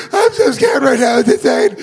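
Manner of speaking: high, slow frightened wail; hammed-up panicked outburst; mock terrified victim. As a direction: imitate someone scared out of their wits. Acts scared victim answering the host.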